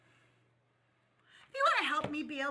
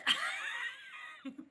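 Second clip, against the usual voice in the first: sudden squealing laugh, far above speaking pitch; real high cackle; 3 dB quieter than usual. Real, not acted. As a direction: burst out laughing high mid-sentence. Bursts out laughing mid-sentence.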